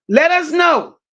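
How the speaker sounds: shouted, stressing us; calling out. Real, not acted. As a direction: call it out to the whole chat. Repeating it louder for chat.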